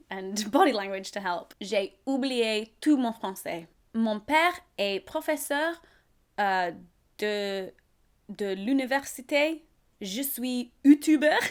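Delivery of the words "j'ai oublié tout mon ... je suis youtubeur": halting broken French; drunk French. Pretend. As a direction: stumble through slow schoolbook French. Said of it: "I'm just like".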